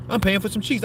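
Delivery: pushed up high to drive the point home. Shown as a demonstration. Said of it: "If I'm paying for some cheeks".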